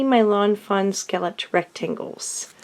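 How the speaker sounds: ordinary delivery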